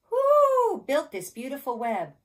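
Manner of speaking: high, loud question opening on a hoot; storybook owl. A narrator's reading. Owl asking who built the web, high hooting question. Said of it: "asked the owl".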